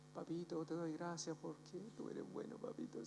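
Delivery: whisper-soft thanks to a loving dad; tender prayer. Retold or imitated. Young man praying to God as his papito, hushed and tender. First heartfelt prayer, about to cry.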